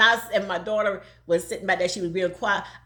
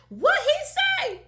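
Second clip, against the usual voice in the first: mock indignant; 3 dB louder, much slower than usual.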